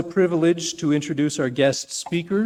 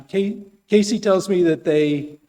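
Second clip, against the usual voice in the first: usual speaking voice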